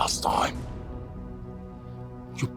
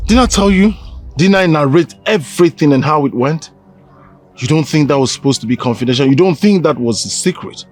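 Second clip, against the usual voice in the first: angry accusation; 4 semitones higher, 5 dB louder than usual. Acted. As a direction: raise your voice, louder and higher. Angry accusation of his friend.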